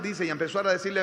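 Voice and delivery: ordinary talk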